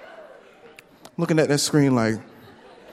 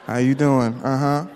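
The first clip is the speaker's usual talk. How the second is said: put-on bitter ex-girlfriend. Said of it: "like a bitter ex-girlfriend".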